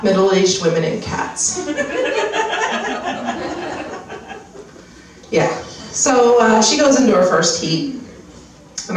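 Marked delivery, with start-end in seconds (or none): 1.7-4.3 long high airy laugh; breathy laugh. Real, not acted. Comedian laughing at own edibles joke, high airy laugh. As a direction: laugh at your own punchline.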